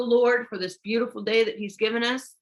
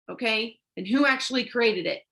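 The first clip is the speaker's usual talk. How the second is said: ordinary delivery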